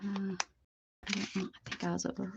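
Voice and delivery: whispered to herself. Real, not acted. Lost her place, muttering to herself.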